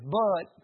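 But said firmer and fuller; stress on But. Shown as a demonstration. Punch the word But. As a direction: pastor coaching the church on how to say But. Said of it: "you gotta say it like this".

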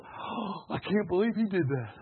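hushed, breathy gossip; gossiping whisper. Pretend. Preacher mocking a churchgoer gossiping in a hushed whisper.

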